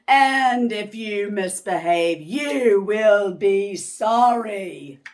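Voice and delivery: slow, loud menacing threat; threatening witch. Acted. Miss Swamp warning the kids, slow loud threat. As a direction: threaten them with every word. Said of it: "said Miss Swamp".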